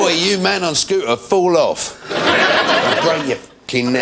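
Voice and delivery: high, laughing shout at a scooter thief; mock angry yell.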